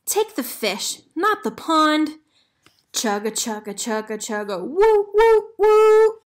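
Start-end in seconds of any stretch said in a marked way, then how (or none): 0.1-2.2 mild yell. Acted. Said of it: "yelled Reel-a-Min Sam". 4.6-6.2 very high, loud whistle hoots; train whistle. Acted. The train blowing its whistle as it leaves, loud.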